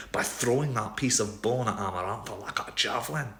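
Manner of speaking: put-on heartfelt Scottish accent; Scottish lilt, proud and fond; Scottish Rhysand. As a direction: tell the story in a broad Scottish accent. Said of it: "Rhysand and Helion speaking definitely in a Scottish accent".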